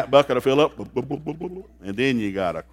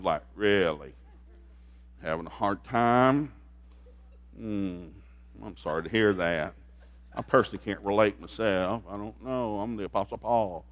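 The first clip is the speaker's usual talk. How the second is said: mock sarcasm; 5 semitones lower, 6 dB quieter than usual. Paul's sarcastic reply to Corinth.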